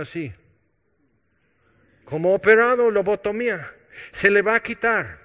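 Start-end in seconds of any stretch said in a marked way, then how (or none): 2.0-5.2 higher and louder burst of comic emphasis; animated exclaim. Real, not acted. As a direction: play up the joke with a lively burst. Bromea sobre hijo adolescente. (exclamación animada, más aguda y fuerte)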